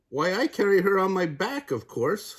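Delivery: high, matter-of-fact crocodile; a surprised crocodile stating the obvious. Acted. Crocodile answers in story.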